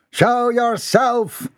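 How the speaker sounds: high, stretched-out demand; challenging call. A narrator's reading. Captain challenges a hidden stalker, high drawn-out call. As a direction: call it out slowly into the dark.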